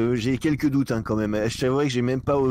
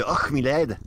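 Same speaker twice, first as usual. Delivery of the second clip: a light attempt at a Scottish accent, a bit louder; 4 semitones higher, 4 dB louder, much slower than usual. After being asked to do a Scottish accent, a lightly voiced Scottish brogue. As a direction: try a hint of Scottish brogue. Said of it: "Tu fais l'accent écossais".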